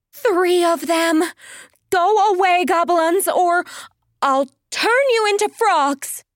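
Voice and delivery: high, panicky warning; panicked threat. Acted. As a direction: scare them off in a fright. Pearl threatens imagined goblins in panic.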